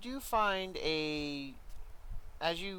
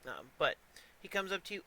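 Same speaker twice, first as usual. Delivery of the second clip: normal talking voice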